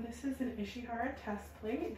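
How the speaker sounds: ordinary delivery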